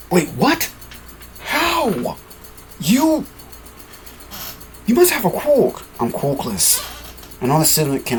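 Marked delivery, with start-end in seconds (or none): 0.1-5.8 put-on high, startled young fighter; stunned character's high voice; shocked high voice. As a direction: gasp it out in disbelief, pitched way up.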